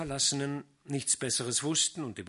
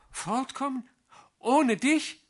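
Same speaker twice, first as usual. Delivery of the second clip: put-on high, pleading young girl; girl's dismayed reply; 11 dB louder, slower than usual. A narrator's reading. Vrenchen protesting to Sali she won't go without him, high and pleading. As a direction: Vrenchen aghast at being sent away.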